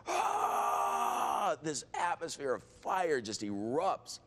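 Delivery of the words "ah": high loud imitated roar of a crowd. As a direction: let out a roar like the whole room. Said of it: "starts roaring".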